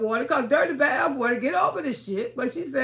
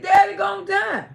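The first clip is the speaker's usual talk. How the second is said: high, louder re-enacted outcry; retold hollering; 7 semitones higher, 5 dB louder than usual. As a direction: holler the dreaded news as if reliving it. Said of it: "I started screaming and hollering".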